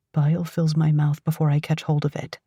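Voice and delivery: ordinary delivery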